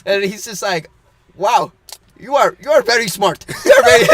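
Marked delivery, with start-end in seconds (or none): 1.4-4.0 louder mock Indian accent, amused; mimicked Indian-accented admiration; imitated Indian accent. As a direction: praise them in a put-on Indian accent. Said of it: "he has an accent".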